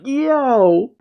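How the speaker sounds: high-pitched cartoon yelp of pain; Luffy. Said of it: "And Luffy goes".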